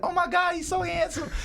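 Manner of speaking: high-pitched imitation of a gushing woman; gushing admiration. Blind woman shown a picture of his son, gushing. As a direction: coo it excitedly, voice way up high.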